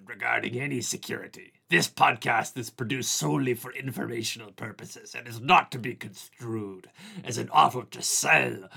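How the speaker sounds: growly hammed-up pirate reading a disclaimer. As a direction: ham it up like a swashbuckling pirate. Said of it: "read it in my very best pirate voice".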